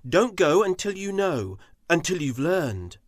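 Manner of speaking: urgent. Acted. Shao warns urgently.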